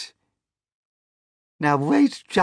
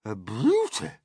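raised, admiring aunt voice; admiring cry; 4 semitones higher, 5 dB louder than usual. A narrator's reading. Aunt first admiring the peach, a contained cry. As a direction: begin the admiring cry with some restraint. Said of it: "Aunt Sponge cried out".

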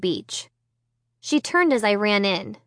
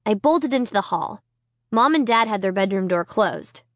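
plain reading voice

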